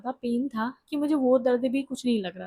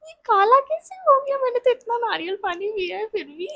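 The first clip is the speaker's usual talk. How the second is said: much higher, louder squeal of disbelief; shocked inner thought; 10 semitones higher, 8 dB louder than usual. Retold or imitated. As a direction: squeal in comic disbelief at the dark baby. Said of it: "मैंने वो मन में सोचा".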